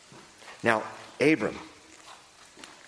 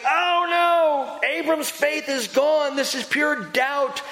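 high, slow mock alarm, gasping at Abram's doubt; mocking critics; 13 semitones higher, slower than usual. Preacher mimicking critics who call Abram's questions doubt, in a high, slow, mock-alarmed voice. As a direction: squeal it like a scandalized critic. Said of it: "And some say".

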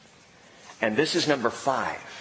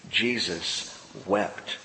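slow, low and much softer, almost held back; solemn hush; 8 semitones lower, 6 dB quieter, much slower than usual. A narrator's reading. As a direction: let the two words sink in slowly. Solemn reading of Jesus wept.

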